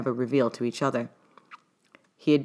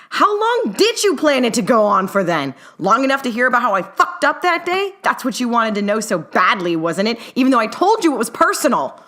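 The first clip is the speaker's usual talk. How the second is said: higher, louder angry challenge; bitter accusation; 7 semitones higher, 5 dB louder than usual. A narrator's reading. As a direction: throw his words back at him. Newt keeps accusing Herman.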